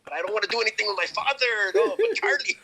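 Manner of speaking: high, mocking Mexican dad, building to a wail; hammed-up father parroting his son, pitch climbing; imitated ranting father. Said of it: "does the impression of his father".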